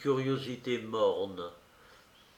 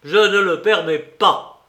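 loud, raised-pitch cry; angry outburst; 7 semitones higher, 12 dB louder than usual. A narrator's reading. Suspect pounds the table at the investigator, shouting abruptly. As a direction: burst out in sudden fury. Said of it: "atteignit le diapason le plus élevé".

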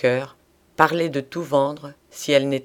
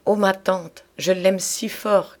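fervent exclamation, pitched higher and drawn out; cri du cœur; 6 semitones higher, slower than usual. A narrator's reading. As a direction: cry it out higher, lingering on the feeling. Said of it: "cria Nana". (exclamation fervente, plus aiguë et étirée)

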